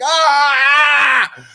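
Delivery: sudden high scream of panic. Retold or imitated. Imagining the massager crushing him, a mock scream. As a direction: shriek as if the massager crushes your feet.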